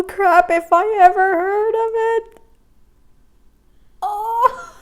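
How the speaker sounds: high, loud laugh-talking; laughing rant. Real, not acted. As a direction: rant while still laughing. Laughing rant, kombucha comparison.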